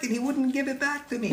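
put-on very high, urgent little girl voice; little girl complaining. Little girl runs up to tell the teacher, very high and urgent. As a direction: tattle to the teacher in a tiny high voice.

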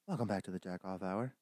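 low, smooth fake show intro; put-on host voice; mock radio host. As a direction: drop low like a late-night radio host.

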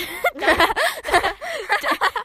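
high giggling burst; burst of laughter. Real, not acted. Girl laughing at her own cheeky joke to listeners.

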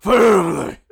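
high, loud hammed-up cry; mock outraged shout. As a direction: shout it like a wounded patriarch.

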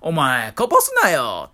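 imitating a mum loudly telling off her kid; loud mother's scolding. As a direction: retell a coarse mother's loud reprimand. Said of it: "大声で喋っていて".

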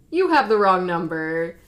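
loud retold dismissal; abrupt brush-off. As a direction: shut the caller down loudly. [name] brushes off Jessica.

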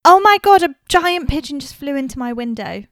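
jolted by a pigeon hitting the window; startled. Real, not acted.

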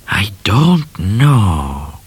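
very slow unvoiced whisper. A narrator's reading. Midnight admits he has no plan, whispering slowly. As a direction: breathe it out, stumped.

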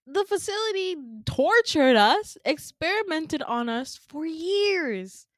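high, loud indignant protest; anguished accusation. Retold or imitated. Group reminding Peach of the facility, anguished accusation. Acted confrontation with the betrayer.

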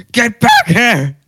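high, loud, slow yell; teacher's scream. Retold or imitated. Teacher screaming at runaway kid, loud high yell. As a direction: bellow it across the field like the furious teacher. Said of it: "the teacher screamed".

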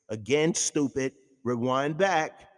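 usual speaking voice

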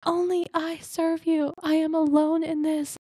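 high, pleading prophet voice, far above her own; Elijah's lament. Retold or imitated. Elijah alone in the cave, pleading with God, high and strained. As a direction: cry out to God as a lonely prophet. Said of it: "whenever he's like".

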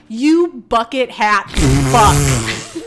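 retold outburst, heavily punched; snapping insult. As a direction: spit out each word of the insult. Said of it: "and I do snap".

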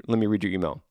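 ordinary delivery

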